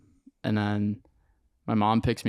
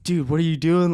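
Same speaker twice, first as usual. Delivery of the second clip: high, loud panicked demand; higher and louder, a son panicking at his dad; freaking out; 7 semitones higher, 6 dB louder than usual. Retold or imitated. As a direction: blurt it in rising panic. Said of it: "Like, I started freaking out".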